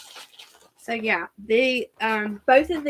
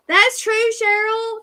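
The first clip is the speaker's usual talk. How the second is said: excited; 11 semitones higher, 5 dB louder, slower than usual. Real, not acted. Agreeing with a viewer comment.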